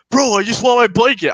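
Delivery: high, loud mock pleading; put-on wail of a demand; insistent homeless man. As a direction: imitate a man hollering through a door. Imitates homeless man demanding blanket.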